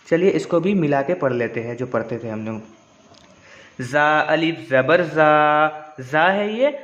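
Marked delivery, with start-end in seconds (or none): 3.8-5.9 जा stretched long, very slow; drawn-out vowel. Shown as a demonstration. Teacher shows the long जा, slow and stretched. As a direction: draw the जा out. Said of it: "थोड़ा खींच के पढ़े इसको".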